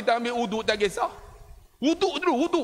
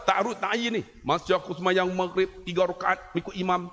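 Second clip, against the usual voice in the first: ordinary talk, nothing marked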